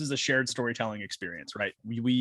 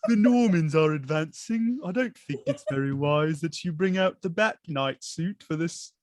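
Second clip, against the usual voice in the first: slow butler voice, laughing through it; Michael Caine impression; 4 semitones higher, slower than usual. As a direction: play the loyal butler warning his master, slow. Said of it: "sounds like a deeper voiced Michael Caine".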